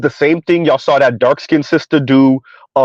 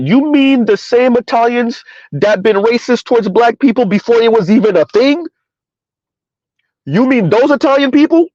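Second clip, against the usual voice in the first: pitched way up in mock disbelief, asking again and again; 8 semitones higher than usual. Real, not acted. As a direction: act stunned that anyone would believe it. Incredulous mock questioning.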